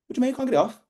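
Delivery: high-pitched, baffled comeback; mock disbelief. Worker refused time off, acting incredulous. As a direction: pitch leaps up as if stunned.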